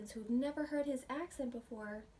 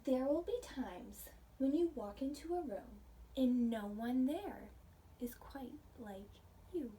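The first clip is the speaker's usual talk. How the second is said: gentle storybook read-aloud for a class; reading to children. Acted. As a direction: read it like a picture book to little ones. Reading picture book to class.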